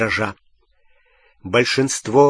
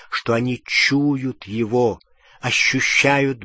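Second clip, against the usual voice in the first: ordinary delivery, nothing marked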